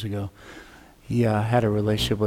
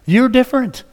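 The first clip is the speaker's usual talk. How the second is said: amused surprise; 11 semitones higher, 6 dB louder than usual. Retold or imitated. Pastor reacts to shaved head.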